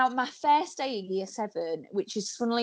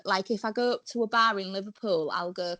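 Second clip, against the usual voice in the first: ordinary talk, nothing marked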